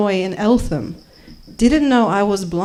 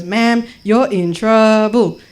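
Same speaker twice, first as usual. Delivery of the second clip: loud, slow official warning; security guard; 5 dB louder, slower than usual. Acted. Guard stopping traveller at airport, slow loud warning. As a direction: draw it out as a stern guard. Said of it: "this security guard appears and goes".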